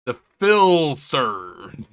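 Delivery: "Philster" stretched very slowly and loudly; drawn-out nickname. Acted. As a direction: draw out "Philster" like a hammy announcement. Announces nickname, drawn-out take.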